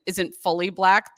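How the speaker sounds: ordinary talk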